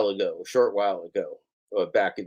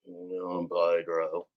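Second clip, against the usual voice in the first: lower, softer mock voice of a rival streamer; Pedro impression; 5 semitones lower, 6 dB quieter than usual. Mocking rival streamer to his chat, in a lower, softer put-on voice. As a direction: drop the voice low and quiet to poke fun at him. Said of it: "this is my Pedro impression".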